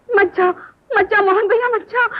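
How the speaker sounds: high, urgent begging; desperate pleading. Acted. Woman begging brother-in-law not to leave, desperate. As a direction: beg him to stay, voice rising.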